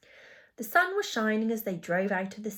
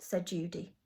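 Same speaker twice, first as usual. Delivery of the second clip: usual speaking voice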